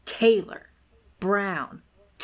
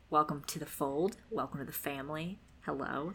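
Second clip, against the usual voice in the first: soft, quick welcome; hushed welcome; 5 semitones lower, 8 dB quieter, much faster than usual. Pretend. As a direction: murmur it warmly and fast. Welcoming new patron softly.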